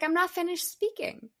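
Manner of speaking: high-pitched, mock-affronted pupil; imitating indignant kid. Funny pupil to classmates talking over him, mock indignation. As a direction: play a child protesting an interruption. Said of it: "he's like".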